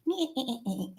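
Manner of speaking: high-pitched witchy cackle; witch cackle. Acted. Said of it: "That's my witch's laugh".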